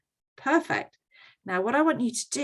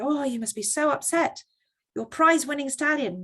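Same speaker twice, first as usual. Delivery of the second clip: high-pitched mock sympathy; commiserating neighbours; 6 semitones higher than usual. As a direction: fuss over the farmer's bad luck. Neighbours voiced commiserating with farmer.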